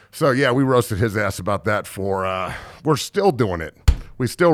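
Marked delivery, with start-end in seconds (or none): none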